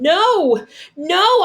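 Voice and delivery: high, loud pleading cries; a boy crying out as they drag him away; desperate begging. A narrator's reading. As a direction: beg them to stop with all you have. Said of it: "I begged".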